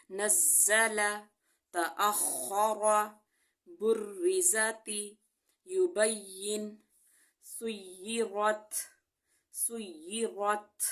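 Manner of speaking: slow, careful Quranic word drill; tajweed recitation. Shown as a demonstration. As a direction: stretch each word out slowly. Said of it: "recites tajweed practice words". (تجوید کے ساتھ آہستہ تلاوت)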